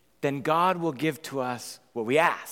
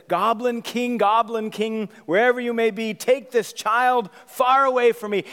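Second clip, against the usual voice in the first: high, loud chanted summons; big-sister incantation to the goblin king; dramatic incantation; 7 semitones higher, 5 dB louder than usual. Acted. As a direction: call on the goblin king like casting a spell. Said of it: "And then Sarah says".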